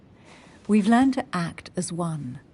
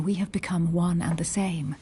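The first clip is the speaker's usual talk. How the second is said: usual speaking voice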